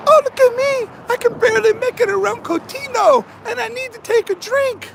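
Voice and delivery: high whiny mock loser voice; mocking a weak loser. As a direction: sneer at the quitter in a high whine. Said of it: "Not a loser".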